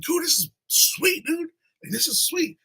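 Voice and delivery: high, slow, awed praise; amazed customer. Retold or imitated. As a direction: gush like you can't believe the place. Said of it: "It's like".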